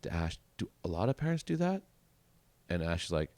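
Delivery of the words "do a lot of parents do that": put-on higher, innocent kid question; imitating young boy. Boy asking the counselor about parents, higher innocent voice. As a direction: ask it wide-eyed like a twelve-year-old.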